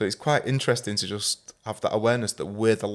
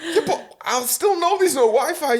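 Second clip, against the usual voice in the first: put-on much higher, louder sulky teen; mock teenage whine; 4 dB louder than usual. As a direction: drag it out like a stroppy teen. Voicing stepdaughter in story.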